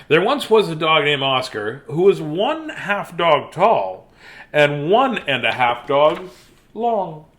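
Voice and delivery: usual speaking voice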